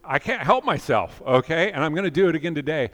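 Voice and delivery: ordinary delivery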